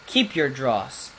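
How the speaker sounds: ordinary delivery, nothing marked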